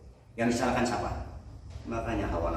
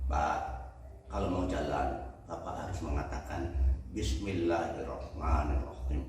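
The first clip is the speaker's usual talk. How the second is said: lower, patient owner voice, lightly voiced; calm camel owner; 5 semitones lower, 4 dB quieter than usual. Camel owner calmly instructing the traveler, low patient voice. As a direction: explain it gently like a patient old owner.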